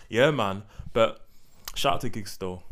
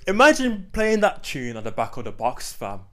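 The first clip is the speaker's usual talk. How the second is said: excited; 6 semitones higher, 8 dB louder than usual. Real, not acted. Excited reminiscing.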